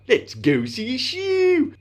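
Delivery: high, loud, drawn-out silly voice. Pretend. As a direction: squash the vowels and send the last word sky-high. Repeats the silly catchphrase.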